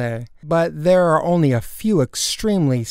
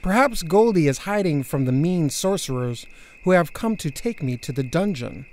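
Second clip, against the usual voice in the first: ordinary delivery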